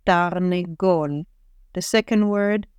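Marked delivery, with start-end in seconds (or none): 0.1-1.2 Tarnegol drawn out syllable by syllable; slow reading. Shown as a demonstration. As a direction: read Tarnegol slowly, each syllable clear. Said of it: "I'll read it slower".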